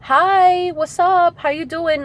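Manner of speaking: high, sugary hello; mimicking an old friend's overly sweet hello; fake friendly greeting. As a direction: play the ex sweet-talking back in. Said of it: "during Mercury retrograde".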